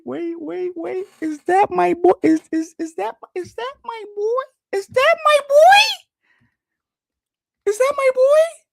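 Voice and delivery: very high, loud excited squeal. Real, not acted. Streamer spots his boy in the fight, squealing with excitement. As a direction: squeal it, drawing out the last cry.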